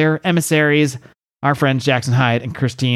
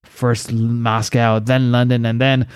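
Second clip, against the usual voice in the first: hammed-up supervillain voice with big laughs; Kaizen Gamora. Villain boasts of his revenge to the UN, hammy and menacing. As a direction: proclaim it grandly, breaking into staged evil laughter. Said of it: "now I'm kind of into it".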